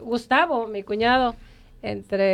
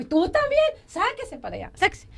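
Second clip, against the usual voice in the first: high-pitched, exasperated retort; mock indignant dismissal; 6 semitones higher than usual. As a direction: shoo him off in mock outrage. Snapping back at her husband in story.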